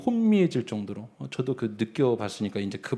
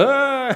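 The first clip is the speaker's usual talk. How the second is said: high mock "와!" cry from the graves; 와 as an eerie high wail; imitated distant shriek; 12 semitones higher, 5 dB louder than usual. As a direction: yelp it like a far-off scream. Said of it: "비명인지 주연지 모르겠는데".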